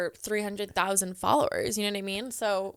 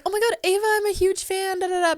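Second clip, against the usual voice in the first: gushing fan; 9 semitones higher than usual. Retold or imitated. Imitating fan gushing over Ava.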